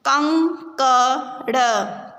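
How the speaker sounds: कंकड़ drawn out very slowly; slow syllable reading. Shown as a demonstration. Teacher sounds out कंकड़ for the class, very slowly. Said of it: "शब्दों को पढ़ेंगे".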